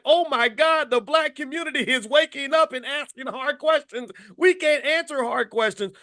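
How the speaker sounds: high, hammed-up panicked voice; mock-terrified handler. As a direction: squeal it like they're caught out. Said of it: "You're like / panic mode".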